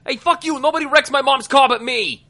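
high, loud angry shout; furious in-character yelling; defiant yell. Acted. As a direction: yell it out at an attacker. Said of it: "I yell".

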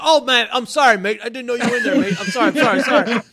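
high, loud mock-Australian apology; lightly put-on Australian, apologies tumbling out; imitated Australian accent. Actor apologizing for kicking in the door, imitated Australian accent. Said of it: "'cause he's Australian".

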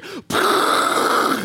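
a quick unvoiced burst of rushing water; mock water-spray sound; imitated spraying noise. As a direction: make the noise of the broken hose spraying. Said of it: "and I just hear".

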